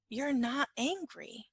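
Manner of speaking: usual speaking voice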